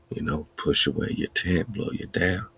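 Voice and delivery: usual speaking voice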